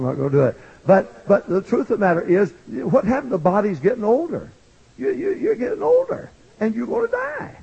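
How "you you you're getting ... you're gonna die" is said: high, urgent preaching; fervent preaching. Acted. Preacher warning the church of death, urgent. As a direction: lift the voice to warn them.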